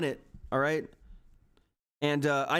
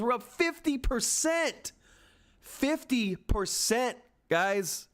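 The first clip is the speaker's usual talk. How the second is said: much higher, slow, savoring fifty; elated announcement; 7 semitones higher, much slower than usual. Real, not acted. Streamer telling viewers the gain, elated and slow. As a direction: stretch it out in disbelief.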